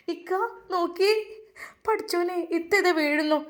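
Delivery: high panicked call for help; alarmed cry. Acted. Nuseeba seeing Faseela faint, alarmed. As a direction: shout in alarm as she falls. Sister sees her collapse.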